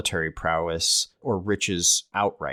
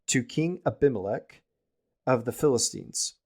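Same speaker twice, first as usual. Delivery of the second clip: ordinary talk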